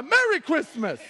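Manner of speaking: high, loud, over-eager greeting; insistent greeting. Retold or imitated. Preacher to people telling him back up, insistent loud greeting. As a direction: belt it out at startled strangers.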